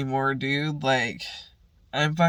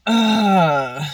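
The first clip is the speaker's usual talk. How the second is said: loud, raised "ugh" of annoyance; exasperated groan; 4 semitones higher, 8 dB louder than usual. Real, not acted. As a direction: groan it out, fed up. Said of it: "so annoyed by that".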